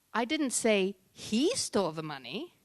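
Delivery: "he" stretched and set apart after a short pause; single out "he" with strong emphasis; stress on HE. Shown as a demonstration. Coach shows emphasis to an audience, stress on "he". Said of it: "Certain emphasis on certain words".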